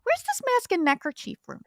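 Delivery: high, eager, awkward friend-seeking voice; squeaky needy sidekick voice; timid Arnold voice. Acted. Player voices Arnold character.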